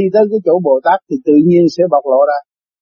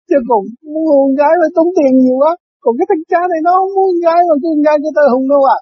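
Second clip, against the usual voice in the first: high, pleading friend voice; friend's plea; 10 semitones higher than usual. Retold or imitated. Friend begging Father Kim for girls, high pleading. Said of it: "ông nói".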